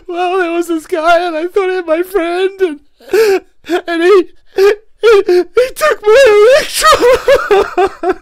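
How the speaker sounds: high, loud, hammed-up sobbing; comic tearful bawling of a wronged little cell; mock crying. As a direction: blubber it out, building to a wail. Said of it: "this little cell crying".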